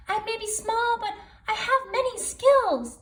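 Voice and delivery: very high little spider voice with a chuckle; small but confident grandmother spider; tiny spider voice. Acted. Grandmother Spider tells Raven she has many skills, high and tiny. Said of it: "said Grandmother Spider".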